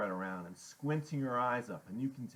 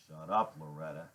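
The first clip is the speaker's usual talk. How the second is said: lowered, firm command; low silencing order; 5 semitones lower than usual. A narrator's reading. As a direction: shut her down in a low voice. Iggy silences her again.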